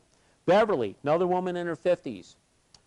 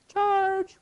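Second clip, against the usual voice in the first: very high, loud sustained call; imitated battle cry; 6 dB louder than usual. Queen sending team to drive barbarians away, imitated battle cry. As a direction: cry out like leading a mock attack. Rallying cry in castle game.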